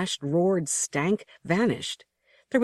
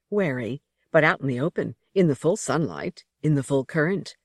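usual speaking voice

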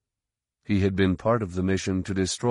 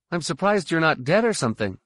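put-on teen boy voice; 8 semitones higher, faster than usual. A narrator's reading. Ben's voice.

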